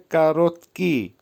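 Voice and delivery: কারোতকি split as কা-রোত-কি; teacher modelling কারোতকি clearly; careful pronunciation demo. Shown as a demonstration. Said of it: "উচ্চারণ হবে". (কারোতকি-র স্পষ্ট উচ্চারণ)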